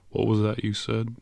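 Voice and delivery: plain reading voice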